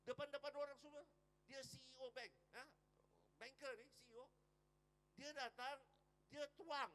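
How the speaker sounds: high, loud dramatic telling; excited storytelling. A narrator's reading. Preacher tells the congregation the dramatic climax, excited. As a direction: build the scene up big.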